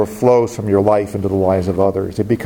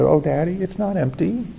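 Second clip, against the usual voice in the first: put-on little girl, tearful; 7 semitones higher than usual. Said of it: "tears in her eyes".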